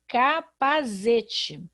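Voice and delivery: capazete with a z sound in place of the s; wrong reading. Shown as a demonstration. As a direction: read capazete with a buzzing z. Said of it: "eu teria que ler".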